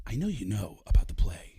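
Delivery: loud confiding stage whisper; Baldwin whisper. Retold or imitated. Baldwin confessing to Pacino, whispered. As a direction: hush it out earnestly. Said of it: "And he goes".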